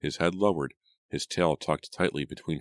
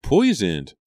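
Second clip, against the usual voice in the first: loud, high, tearful-scene exclamation; aghast repetition; 7 semitones higher, 9 dB louder than usual. A narrator's reading. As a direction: repeat the word in raised shock. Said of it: "Julia repeated the word".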